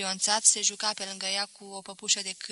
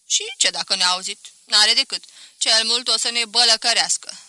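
put-on defiant old spinster; 8 dB louder than usual.

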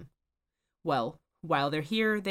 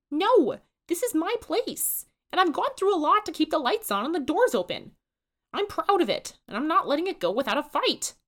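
higher, louder stubborn drawl; insistent drawl; 12 semitones higher, 7 dB louder than usual. A narrator's reading. Susan refuses to leave the diner, defiant high drawl. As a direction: plant your feet, proud and defiant. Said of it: "insists Susan in her rich, weird drawl".

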